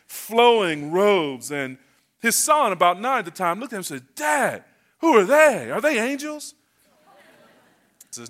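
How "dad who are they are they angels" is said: put-on high-pitched child's question, punched on WHO; wide-eyed boy. Nine-year-old son asks his dad in church, wide-eyed. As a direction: lightly play an amazed little boy. Son's awed question voiced in story.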